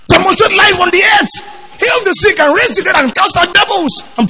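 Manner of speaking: full-throated pulpit call to action; preaching at full force. Acted. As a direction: raise the roof on the commands.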